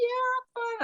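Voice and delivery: high-pitched insistence; mock brush-off. Answering the worried woman after falling, mock brush-off. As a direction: toss it off in a high voice.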